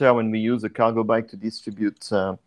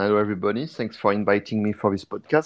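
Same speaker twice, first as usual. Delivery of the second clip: ordinary delivery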